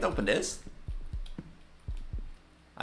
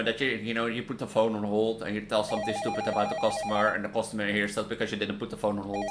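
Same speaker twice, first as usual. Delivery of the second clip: ordinary talk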